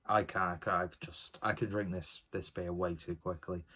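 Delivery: normal talking voice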